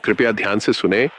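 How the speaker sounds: ordinary delivery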